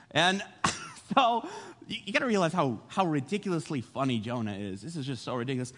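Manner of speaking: normal talking voice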